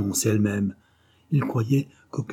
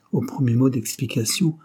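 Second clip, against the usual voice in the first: ordinary delivery, nothing marked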